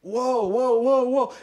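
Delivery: high-pitched mock alarm; imitated high, repeated "whoa"; startled. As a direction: reenact coming to, startled by a crowd. Acting out waking on the floor.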